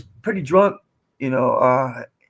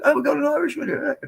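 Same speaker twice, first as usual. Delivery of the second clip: mocking barrister voice; 11 semitones higher than usual. Retold bar taunt, Irishman.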